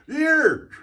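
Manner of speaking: high, yelped West Country Devon "Here!"; Devon accent. Retold or imitated. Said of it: "So they talk down in Devon".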